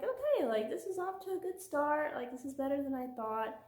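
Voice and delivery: high, bright hopeful reaction; upbeat surprise. Retold or imitated. Reviewer quoting her hopeful first take. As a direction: pitch way up, pleased it's going well. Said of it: "and I was like".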